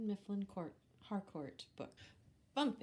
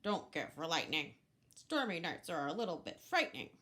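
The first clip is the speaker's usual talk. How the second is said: nervous goose; 3 semitones lower, 4 dB louder, faster than usual. Acted. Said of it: "said Goose".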